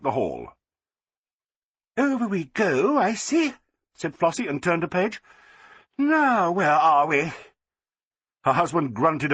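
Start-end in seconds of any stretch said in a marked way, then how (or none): 2.0-3.5 high, breezy society lady; Flossie, chirpy. A narrator's reading. Flossie reading the catalogue. 6.0-7.3 high, breezy society lady; Flossie, chirpy. A narrator's reading. Flossie searching the catalogue.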